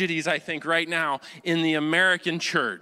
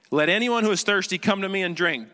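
higher, projected proclamation; big bold voice; 4 semitones higher than usual. Acted. Jesus standing up amid the festival crowd, big bold voice. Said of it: "in this big, bold voice".

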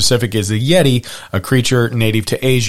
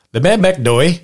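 higher-pitched stab at a Scottish accent; attempted Scottish accent; 6 semitones higher than usual. Shown as a demonstration. Host tests a Scottish mountain name, botched Scottish accent. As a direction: try the name in a poor Scottish accent, questioning. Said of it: "Should I say that in a Scottish accent".

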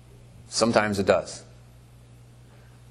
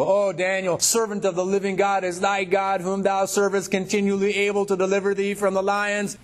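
king's high, plaintive call; lamentable cry; 10 semitones higher than usual. Acted. King calling to Daniel in the lions' den, lamentable cry. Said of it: "he cried with a lamentable voice".

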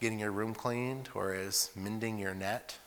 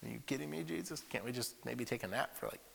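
softer, pleading whine; tired whine; 3 semitones higher, 10 dB quieter than usual. Retold or imitated. Disciples begging Jesus for a nap, soft whine.

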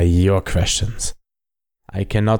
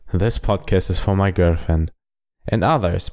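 normal talking voice

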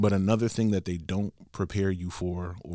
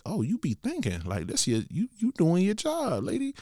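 excited praise; 9 semitones higher than usual. Retold or imitated. Mock-praising the travel agent.

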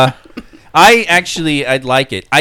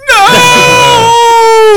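dramatic no; 7 dB louder than usual. Acted. Mock despair at diagnosis.

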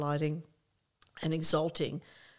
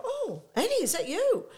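high-pitched squeal of surprise; gushing, high mock surprise; delighted recognition; 3 dB louder than usual. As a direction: act out spotting an old friend. Retelling her delighted recognition.

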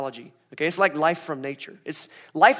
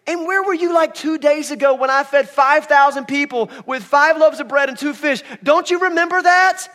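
exasperated Jesus; 10 semitones higher, 10 dB louder than usual. Retold or imitated. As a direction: scold them for forgetting. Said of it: "I would say".

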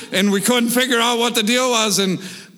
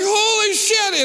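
shocked; 12 semitones higher, slower than usual. Retold or imitated. Facing the bear in shock.

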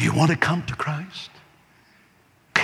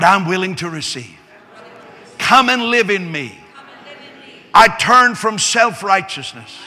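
loud, slow, higher prayer at full force; fervent prayer; 5 semitones higher, 9 dB louder than usual. Acted. Preacher leading the congregation in prayer, loud and fervent.

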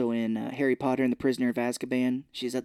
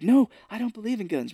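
higher, prim mock protest; earnest refusal; 8 semitones higher, 4 dB louder than usual. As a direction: say it like a prissy objection. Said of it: "he was like".

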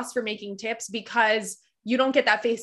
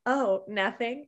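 slow, softer tease with laughter; coy customer; 5 dB quieter, slower than usual. Retold or imitated. Customer who summoned the waitress for nothing, coy. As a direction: draw it out quietly, half laughing. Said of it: "turn their little light on at the table".

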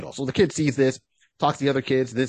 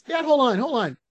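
put-on high-pitched kid voice; high voice, asking Dad to wait; hunter's young son; 12 semitones higher than usual. Son pauses chat to take aim.

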